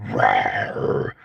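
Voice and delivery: a low, rasping growl with no voice; raspy smoker growl. Shown as a demonstration. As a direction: growl it like wrecked lungs. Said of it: "made me sound like this in the future".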